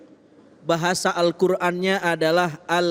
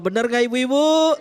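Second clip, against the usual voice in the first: booming prize announcer; 7 semitones higher than usual. Acted. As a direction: pump up the audience. Quiz host hypes audience.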